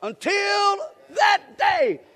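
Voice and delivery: very high, loud, slow shouted phrase; even bigger shout. Shown as a demonstration. Preacher leading the congregation in the phrase again, big shout. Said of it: "One more time".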